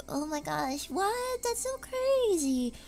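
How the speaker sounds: mock her own tired tone; quietly deadpan, imitating her own low energy; low-energy self. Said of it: "I've been so like".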